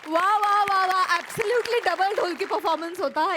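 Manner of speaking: enthusiastic praise. Real, not acted. Judge applauds performance.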